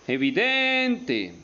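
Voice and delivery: "Evidente" with DEN stretched long and high; stress on DEN. Shown as a demonstration. Teacher showing the accent in den, "Evidente" with drawn-out high DEN. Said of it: "tiene el acento en den".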